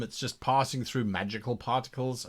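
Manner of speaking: usual speaking voice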